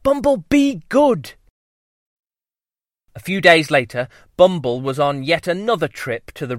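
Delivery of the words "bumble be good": high stern mother bee. Acted. Mum scolds.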